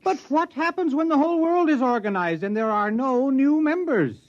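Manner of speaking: alarmed question. Acted. Man asking the scientist what happens after the world joins, alarmed. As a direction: pitch climbing high with rising panic.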